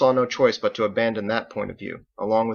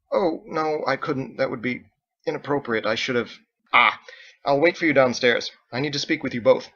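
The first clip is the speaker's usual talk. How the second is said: flustered politeness; 4 semitones higher, 4 dB louder than usual. Acted. Michael flustered, declining.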